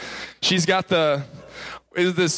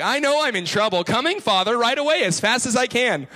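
higher, hurried-to-obey mock reply; dutiful scared boy; 7 semitones higher than usual. Boy answers his stern father, mock eager obedience. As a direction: play up a child jumping at dad's call.

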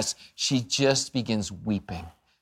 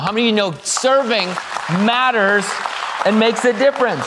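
preaching call; 8 semitones higher, 4 dB louder than usual. Real, not acted. Pastor rallying the congregation.

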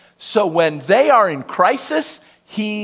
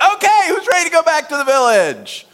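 upbeat group leader; 9 semitones higher, 4 dB louder than usual. Retold or imitated. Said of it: "Terrell's like".

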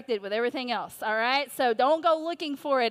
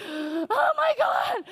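retold tearful gasp; 12 semitones higher, 3 dB louder, much slower than usual. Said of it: "I'm, like, crying".

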